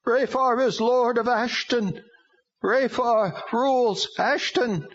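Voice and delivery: high, slow strange demon voice; proclaiming demon. A narrator's reading. Demon answers.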